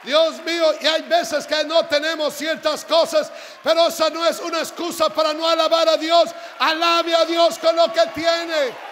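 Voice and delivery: loud, high, shouted exhortation; full-force preaching. Real, not acted. Preacher urging the congregation to praise God, shouted preaching.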